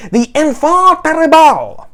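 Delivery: mock French accent, hammed up on purpose; horrible French accent. Mocking David Hogg with a bad French accent. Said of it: "that's a horrible French accent".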